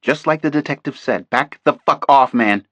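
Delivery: loud, heated telling-off; angry snap. A narrator's reading. Contact man berates agent Thompson, loud angry rebuke. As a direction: blame him and order him back.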